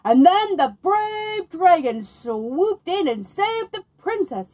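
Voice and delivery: big, high and loud, slowed for drama; slow booming read-aloud to lure the dragon. Acted. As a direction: read it out like a grand storyteller showing off. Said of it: "read aloud in a booming voice".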